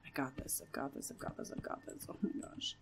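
hushed, anxious pep talk; terrified whisper. Real, not acted. Player steeling themself in the dark, terrified whisper. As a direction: psych yourself up under your breath. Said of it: "really quiet because I'm terrified".

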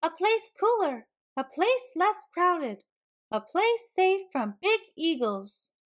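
high, emphatic calling voice; mild storybook shout; shouted invitation. A narrator's reading. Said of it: "they shouted".

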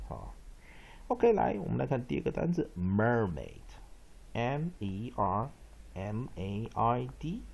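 ordinary delivery

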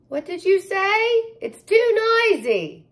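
high, loud shout over noise; yelling. Acted. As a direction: call out over a noisy crowd. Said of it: "yelled her mother".

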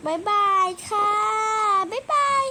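high, loud bright bye-bye; squealing sign-off with a kiss; excited goodbye. Real, not acted. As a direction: wave the viewers off with a squeal. Cheery vlog sign-off.

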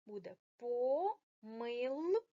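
помилка drawn out slowly; slow reveal. Shown as a demonstration. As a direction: announce помилка as the answer, stretched. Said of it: "вставити К".

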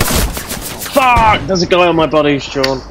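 high, startled swearing as an enemy appears; alarmed outburst. Real, not acted. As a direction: shout it in sudden panic. Enemy suddenly on top of him.

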